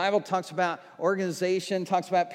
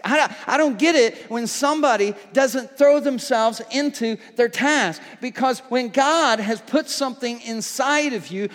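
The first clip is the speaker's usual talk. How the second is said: a touch louder, driving sermon voice; full-force preaching; 3 semitones higher, 5 dB louder than usual. Acted. Pastor urging the church to pray without giving up, preaching hard. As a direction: push the congregation toward bulldog faith.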